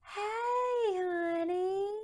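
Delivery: high, long-held singsong greeting; drawn-out greeting. Retold or imitated. Relative greeting someone on a call, high drawn-out hello. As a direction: stretch it out high and sweet, imitating a caller.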